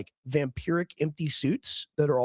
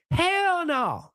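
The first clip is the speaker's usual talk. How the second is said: fast question, then a much higher mocking hell no; sarcastic hell no; 12 semitones higher than usual. Asking if promises were kept, then mocking with a high hell no. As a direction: scoff the answer up high.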